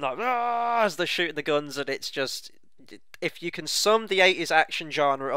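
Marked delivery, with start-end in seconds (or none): 0.2-0.8 high, drawn-out mock battle yell at usual volume; mock scream. Host imitating the team firing into the jungle, mock scream. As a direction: imitate soldiers screaming, held high without real force. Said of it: "They're all screaming".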